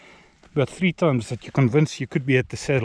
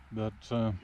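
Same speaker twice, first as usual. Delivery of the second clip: chewing while talking; mouth full; 2 semitones lower, 6 dB quieter, faster than usual. Real, not acted. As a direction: talk through a mouthful. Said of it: "Sorry for talking with food in my mouth".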